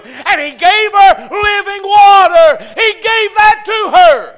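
high, shouted Bible storytelling; full-force preaching. Real, not acted.

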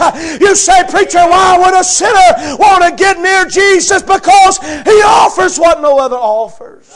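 crying out the sermon climax; preaching at full force. Acted. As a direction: lift the congregation with rising fervor.

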